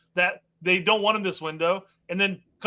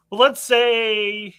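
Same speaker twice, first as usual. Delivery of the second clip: slow setup; 5 semitones higher, 6 dB louder, much slower than usual. Real, not acted. Setting up an analogy slowly.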